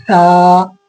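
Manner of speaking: "सा" with tongue between the teeth, blowing air; airy tha. Shown as a demonstration. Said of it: "उसी हवा के साथ इसको पढ़ना है".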